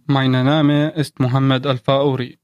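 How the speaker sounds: ordinary talk, nothing marked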